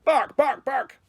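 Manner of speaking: high, sharp word-barks; excited little dog barking. A narrator's reading. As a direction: say each bark like a yap. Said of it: "and barked".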